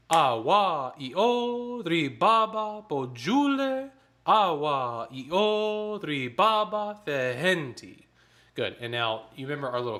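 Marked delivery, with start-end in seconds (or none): 0.1-8.0 rhythmic text, higher and brisker; spoken in rhythm, faster. Shown as a demonstration. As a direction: speak the syllables in tempo, with accents. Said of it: "The second time I'll get a little bit faster".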